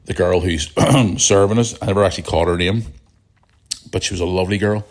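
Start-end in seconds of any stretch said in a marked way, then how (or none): none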